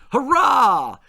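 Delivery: loud high hurrah; cheering. A narrator's reading. The boys cheer about kedgeree breakfast, loud cheer. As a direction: cheer it out with joy. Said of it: "cheered the two boys".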